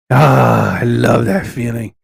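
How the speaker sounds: mustache twirling villain. Acted. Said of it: "where is my villain laugh?".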